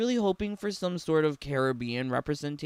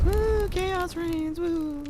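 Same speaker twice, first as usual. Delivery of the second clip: high, slow, hammed-up whoop; mock cheer; 14 semitones higher, slower than usual. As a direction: cheer it like a cartoon villain fan, much higher. Mock cheer while watching trailer.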